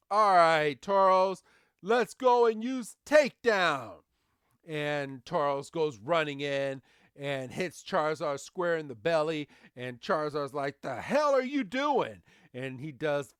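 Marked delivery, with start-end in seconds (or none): 0.1-4.0 loud, raised battle command; cocky trainer. Retold or imitated. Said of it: "this cocky trainer is like". 10.8-12.2 high-pitched indignant retort; mock outrage. Charizard hit in belly, retorts.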